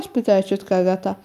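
usual speaking voice

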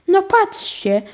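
high-pitched mild astonishment; surprised. A narrator's reading. Aunt finds glasses landing on her nose, surprised. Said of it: "zdziwiła się ciotka Agata".

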